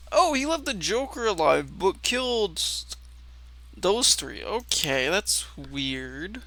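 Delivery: ordinary delivery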